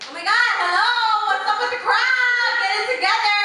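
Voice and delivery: loud, high call to wake up the crowd; rally the audience, big and loud; hyping the crowd. Real, not acted. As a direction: shout it out to a dead room.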